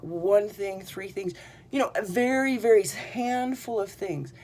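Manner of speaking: ordinary talk, nothing marked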